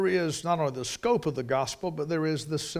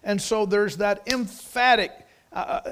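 ordinary talk

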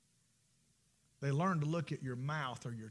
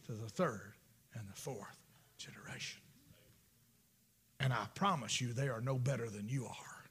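hushed sermon; 6 semitones lower than usual. Real, not acted. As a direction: draw them in with a near whisper. Hushed grave preaching.

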